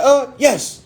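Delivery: high, loud, confident answer; re-enacted big-chested confidence; said with his chest. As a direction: puff up and declare it boldly. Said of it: "I said that shit with my chest".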